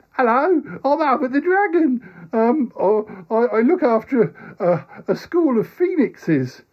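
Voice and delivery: put-on high, slow childlike dragon; young dragon, high and drawn out. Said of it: "he talks a bit like this".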